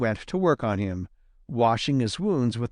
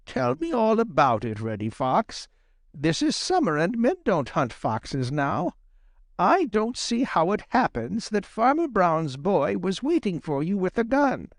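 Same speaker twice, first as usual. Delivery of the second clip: higher-pitched puzzled granny fox; questioning Granny Fox; 6 semitones higher than usual. A narrator's reading. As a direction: question the young fox in a raised voice.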